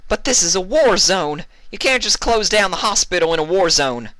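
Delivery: high, loud indignant protest; angry arguing. Acted. Applejack argues angrily with the nurse, loud. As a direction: argue back in outrage. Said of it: "she argued, beginning to get angry".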